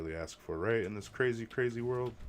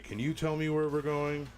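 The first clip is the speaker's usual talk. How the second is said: put-on higher, dazed drunk asking from the back seat; drunk passenger voice; 8 semitones higher, 5 dB louder than usual. Retelling the drunk Uber rider asking the driver, higher dazed voice. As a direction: play the lost drunk rider, pitched up.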